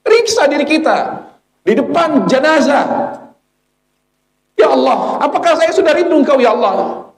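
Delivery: high, pleading exhortation; impassioned preaching. Real, not acted. Preacher facing the bier, questioning his own heart, impassioned. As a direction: cry out the question to God.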